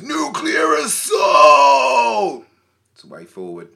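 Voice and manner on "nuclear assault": long, high American bellow; huge drawn-out American fan shout; American accent. Retold or imitated. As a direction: holler it in an American accent, stretching the words. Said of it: "why are you doing an American accent".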